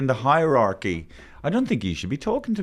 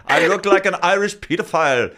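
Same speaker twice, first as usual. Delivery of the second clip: mock German; 8 semitones higher, 5 dB louder than usual. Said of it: "If a German could".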